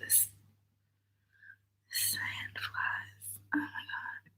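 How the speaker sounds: breathless whisper of disgust. Real, not acted. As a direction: whisper it, creeped out.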